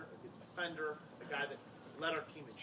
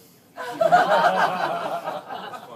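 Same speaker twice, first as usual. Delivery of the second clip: big high laugh; loud burst of laughter; 19 dB louder than usual. Real, not acted.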